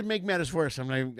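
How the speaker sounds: normal talking voice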